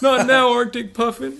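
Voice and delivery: very high, loud cartoon imitation; film character quote. Quoting Buddy the Elf to co-host, high comic character voice. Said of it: "From Buddy the Elf".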